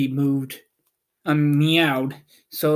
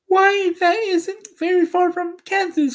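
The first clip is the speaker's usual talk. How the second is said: put-on high, girlish cry; eager Dorothy; 3 dB louder than usual. A narrator's reading. Dorothy realizing Omaha is near Kansas, high girlish cry. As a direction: call it out like an excited little girl. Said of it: "cried Dorothy".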